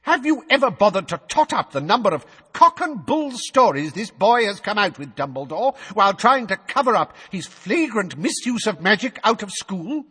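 high, loud accusing official; pompous bureaucrat railing at Dumbledore; ranting minister. A narrator's reading. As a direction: hammer every charge home. Fudge ranting.

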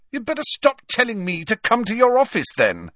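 put-on high, petulant rich boy; defiant sneering teen. A narrator's reading. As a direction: snap back, sulky and proud. Malfoy defies Snape.

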